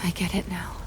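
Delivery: hushed realization. Acted. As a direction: whisper it as it dawns. Nell realizing quietly.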